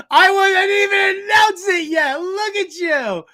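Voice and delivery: loud laugh-cry through the words; cracking up, shouting it out. Real, not acted. Host cracking up at the Jets fan's hype, laughing loud.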